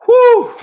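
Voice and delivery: loud high whew of being overwhelmed; a loud whew, moved by viewer emails; emotional whew. Real, not acted. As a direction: blow out a big whew, moved.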